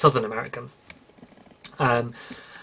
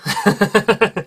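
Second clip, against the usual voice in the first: loud, higher-pitched laugh; 6 semitones higher, 5 dB louder than usual. Real, not acted. Laughs at admitting the number.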